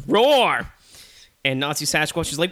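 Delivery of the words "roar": loud, high mock roar; bear roar.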